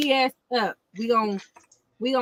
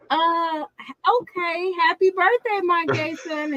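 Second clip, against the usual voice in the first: higher, hesitant mock reading; imitating host reading; 8 semitones higher than usual. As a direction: act out her confused reading. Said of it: "she straight said".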